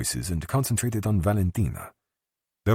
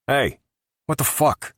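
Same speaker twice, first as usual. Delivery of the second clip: higher, louder outraged yelp; indignant bartender; 12 semitones higher, 5 dB louder than usual. A narrator's reading. Bartender protesting, high and loud. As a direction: bartender yells as customers leave unpaid.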